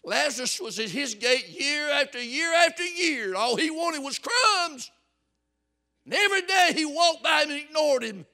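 loud, high fire-and-brimstone preaching; preaching at full force. Acted. Preacher warning the church about hell, full-force shouting. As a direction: thunder it at the congregation.

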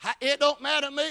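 ordinary talk

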